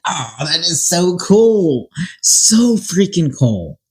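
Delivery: high, slow gushing delight; thrilled. Real, not acted. Telling viewers about her client's fairy life, high slow gush. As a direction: let the wonder spill out, up high and drawn out.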